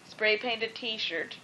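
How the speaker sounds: ordinary delivery, nothing marked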